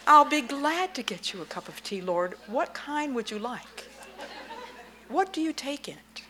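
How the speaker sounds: high, eager, laughing reply; bright gushing welcome, pitched high; delighted wife. Pretend. Wife greeting the Lord in her husband's chair, high and delighted. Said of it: "And you say".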